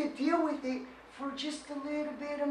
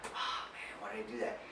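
whispered, much lower self-reproach; mock regret whisper; 12 dB quieter than usual. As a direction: mutter it to yourself in regret. Mock regret after outburst.

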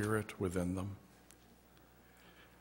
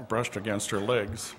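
ordinary delivery

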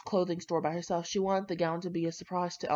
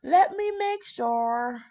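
drawn-out check; 7 semitones higher, 6 dB louder, much slower than usual. Real, not acted.

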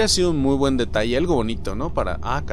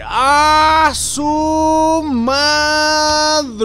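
long, high, loud exclamation; drawn-out scream; 13 semitones higher, 8 dB louder, much slower than usual. Real, not acted. Gamer finding he will drive it, long high scream. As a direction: scream it, stretching each vowel out. Thrilled at driving the thing.

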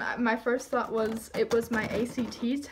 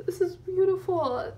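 teary; 6 semitones higher, 4 dB louder than usual. Real, not acted. Moved by favorite band record.

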